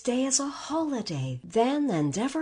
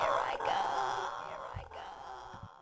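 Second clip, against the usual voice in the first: fading whisper; 11 semitones higher, 8 dB quieter than usual. A narrator's reading. Witch fades as she melts.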